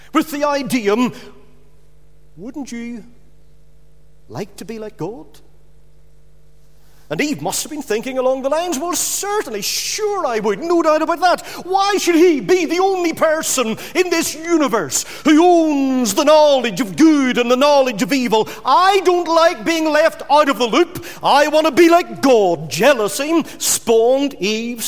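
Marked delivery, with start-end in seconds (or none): 2.4-5.4 very soft, insinuating devil's question; soft tempting. Acted. Devil tempts Eve with the idea, softly coaxing. 8.8-22.7 higher, mounting jealous protest; acted indignation. Eve thinking along jealous lines, acted indignant protest.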